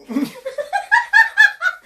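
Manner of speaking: high, loud witchy cackle; witch cackle. Real, not acted. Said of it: "I'm not a witch".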